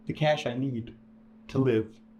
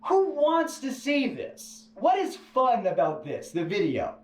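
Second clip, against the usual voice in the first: incredulous; 10 semitones higher, slower than usual. Retold or imitated. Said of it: "And I'm sitting like".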